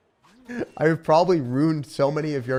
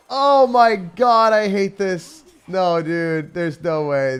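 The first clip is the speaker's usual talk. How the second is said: higher, louder frustration; frustrated outburst; 8 semitones higher, 9 dB louder, much slower than usual. Real, not acted. Streamer's loud frustrated rant at the game.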